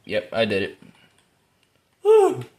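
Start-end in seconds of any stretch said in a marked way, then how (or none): 2.0-2.5 high, loud whoop; excited whoop. Real, not acted. Player whooping mid-match, excited. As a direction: let out a short high woo.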